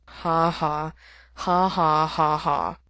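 flat, deliberate fake laugh; sick man fake laughing at a bad joke. A narrator's reading. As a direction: spell out a fake ha ha. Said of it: "fake laughing meant coughing".

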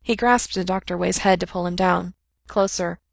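ordinary delivery

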